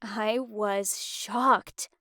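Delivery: ordinary delivery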